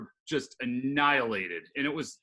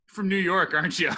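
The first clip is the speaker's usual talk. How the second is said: laughing; 4 semitones higher, 6 dB louder than usual. Retold or imitated. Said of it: "he goes".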